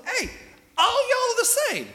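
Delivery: high, loud, drawled country call; folksy Jesus call. Retold or imitated. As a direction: belt it like a good-natured southern preacher. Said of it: "what Jesus is trying to say".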